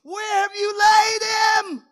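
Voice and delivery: high, loud, slow wailing cry; desperate shouted plea. Acted. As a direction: cry out like a grieving woman. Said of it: "She said".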